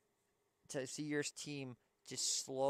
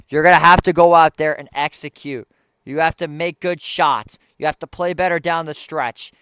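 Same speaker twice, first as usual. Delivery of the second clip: loud, furious fan rant; angry rant; 17 dB louder than usual. Real, not acted. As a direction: yell it at the team. Fan rants at his team.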